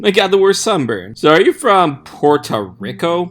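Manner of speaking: high, lively schoolgirl chatter; gush, then ask with breezy curiosity; chatty classmate. Acted. Chatty classmates in acted dialogue.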